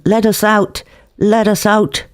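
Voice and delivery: put-on high, wailing drunk. Drunk rattling the wire cage, high mock wail.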